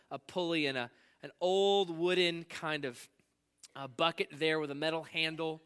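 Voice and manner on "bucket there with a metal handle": ordinary talk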